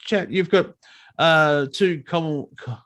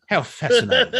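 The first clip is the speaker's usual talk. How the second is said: sarcastic; 7 semitones higher than usual. Pretend. Mocks the American's intervention.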